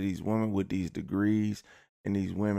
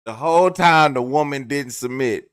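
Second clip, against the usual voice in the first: loud, raised-pitch punchline; exasperated shouting; 4 semitones higher, 9 dB louder than usual. Real, not acted. Comedian driving the point home to viewers, exasperated shouting. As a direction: shout it like it should be obvious.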